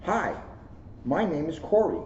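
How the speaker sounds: usual speaking voice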